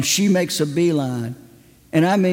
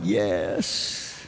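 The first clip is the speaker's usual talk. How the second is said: low, soft inner yes; a quiet private triumph; silent cheer; 10 semitones lower, 7 dB quieter than usual. Retold or imitated. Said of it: "in my heart, I'm going".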